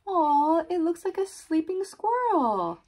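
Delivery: high-pitched aww for little listeners; delighted cooing. Acted. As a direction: coo over a cute sleeping animal. Cooing over the sleeping squirrel.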